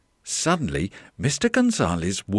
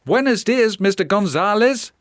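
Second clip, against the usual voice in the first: high, loud storybook greeting; cheery visitors calling hello; called-out greeting; 12 semitones higher, 5 dB louder than usual. Acted. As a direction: greet the shop owner in a high voice.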